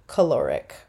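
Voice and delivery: "caloric" as kuh-LOR-ic, falling at the end; correct pronunciation. Shown as a demonstration. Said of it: "It is pronounced caloric".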